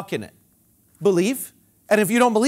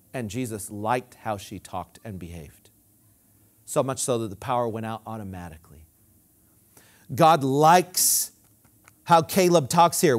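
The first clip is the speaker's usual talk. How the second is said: usual speaking voice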